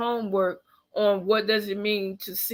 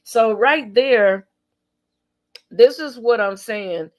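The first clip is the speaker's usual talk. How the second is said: emphatic; 6 dB louder, slower than usual. Real, not acted. Emphatic point in rant.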